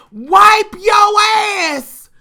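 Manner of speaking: high, drawn-out holler. Retold or imitated. Auntie hollering at the cousin in the bathroom, drawn-out shout. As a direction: bellow it across the house. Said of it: "She hollers this".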